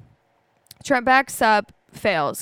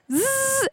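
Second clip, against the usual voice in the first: high, sustained zzz noise; imitated buzzing slide; 14 semitones higher than usual. Storyteller imitates the van in the mud, buzzing. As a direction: buzz like a van sliding in mud. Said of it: "After the van was, like".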